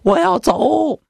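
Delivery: slow, high blubbering cry of a frightened emperor; hammed-up wailing. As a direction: wail it out like a scared child. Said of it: "哇哇大哭啊".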